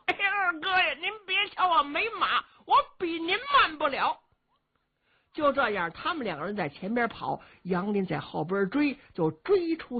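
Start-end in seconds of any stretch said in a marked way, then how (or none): 0.1-4.2 a cheeky simpleton gloating with a snicker; dim-witted young brute. Acted. Luo Shixin mocking the old general, high goofy voice.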